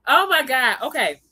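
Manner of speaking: ordinary talk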